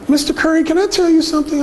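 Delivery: high, coy woman's voice; a caller timidly starting a confession, high coy voice; shy woman imitated. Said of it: "she said".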